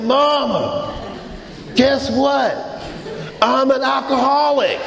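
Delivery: high, gleeful declaration; excited announcement. Retold or imitated. Son phoning his mother with news, excited announcement.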